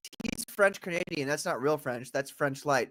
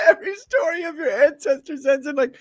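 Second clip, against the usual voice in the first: high, squeezed out through real laughter; laughing hard; 10 semitones higher than usual. Real, not acted. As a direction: crack up while trying to talk. Cracking up at friend's ancestor story.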